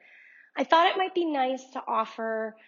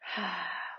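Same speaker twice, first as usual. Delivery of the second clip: soft sigh; 4 semitones lower, 8 dB quieter than usual. Shown as a demonstration. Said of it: "long breath out".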